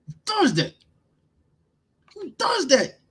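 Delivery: high, incredulous repeat; rising disbelief. Real, not acted. Mocking long snapper pick.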